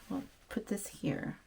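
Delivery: soft unvoiced whisper to herself; breathy whisper, barely there; whispered aside. Real, not acted. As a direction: murmur it under your breath as you set it down. Quiet aside while placing item.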